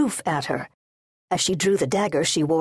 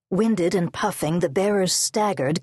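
ordinary delivery

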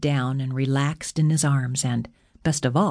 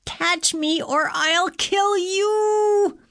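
top-of-voice scream; much slower than usual. A narrator's reading. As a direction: scream it high and stretch the last word out. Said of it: "At the top of her voice, she screamed".

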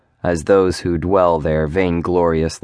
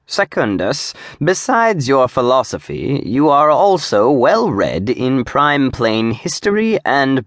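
higher, lilting voice for the demon Zadim, gushing and friendly; Zadim's effusive greeting; 6 semitones higher than usual. Acted. Demon greets his old friend the Provost, higher and gushing. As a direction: play an eager old friend greeting a pal.

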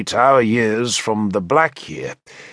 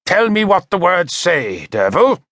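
sharp, raised demanding tone; 6 semitones higher, 3 dB louder than usual. A narrator's reading. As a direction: demand an answer, higher and louder. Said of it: "he demanded".

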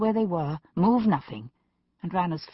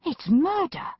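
high-pitched indignant protest; rising outrage; 12 semitones higher than usual. A narrator's reading. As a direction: exclaim it in outrage. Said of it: "Macbeth's voice rose in outrage".

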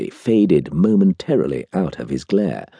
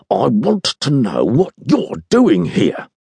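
slow, higher-pitched pointed retort; 6 semitones higher, slower than usual. A narrator's reading. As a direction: retort with deliberate weight on each word. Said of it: "he retorted".

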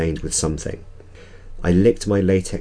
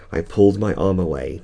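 ordinary delivery

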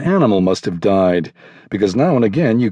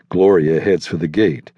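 plain reading voice

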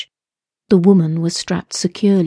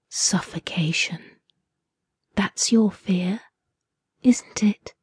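breathy whisper, much softer, unhurried; soft whisper in her ear; 7 dB quieter than usual. A narrator's reading. Captor whispering into the captive woman's ear. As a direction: lean in close and whisper menace.

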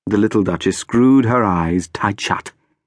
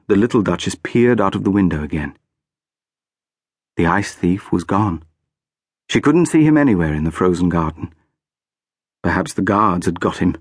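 plain reading voice

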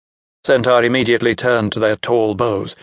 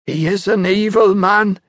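put-on old woman warning; 8 semitones higher than usual. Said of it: "the old woman said".

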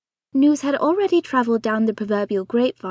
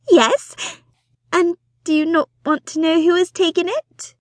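high, loud, breathless society wife; fussy excited mother; 6 semitones higher, 4 dB louder than usual. Acted. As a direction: gush it out like an eager matchmaking mother. Mrs Bonnet pressing her husband.

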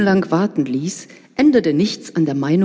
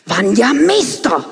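furious bark; 4 semitones higher, 3 dB louder than usual. Acted. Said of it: "blafft Vera stinksauer".

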